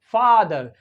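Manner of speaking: the open a of फादर; father vowel. Shown as a demonstration. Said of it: "देखिए American ओ".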